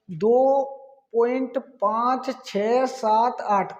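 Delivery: slow, each digit read out separately. Shown as a demonstration. Teacher showing students how to read decimals, slow and digit by digit. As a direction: spell out every digit slowly for the class. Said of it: "एक एक अंक करके पढ़ते हैं".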